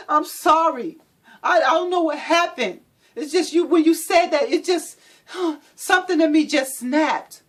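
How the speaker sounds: high, loud near-crying plea; pleading apology. Acted. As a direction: beg forgiveness, voice cracking. Abuser's tearful apology.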